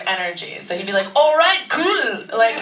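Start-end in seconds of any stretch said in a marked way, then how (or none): 1.1-2.2 higher, louder mock Austrian accent; Christoph Waltz accent. Christoph answering a fan in kind, louder mock Austrian accent. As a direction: imitate a cool Austrian reply, higher and louder. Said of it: "perfect the Christoph Waltz accent".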